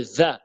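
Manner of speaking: "za" with an interdental th; tongue between teeth. Shown as a demonstration. Teacher reminding learners how the letter is made, interdental "za". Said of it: "on met la langue entre les dents".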